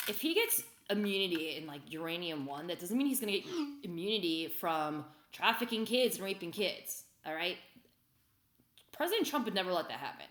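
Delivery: normal talking voice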